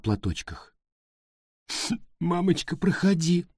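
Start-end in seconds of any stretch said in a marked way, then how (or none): 2.2-3.5 sobbing. A narrator's reading. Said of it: "всхлипывая".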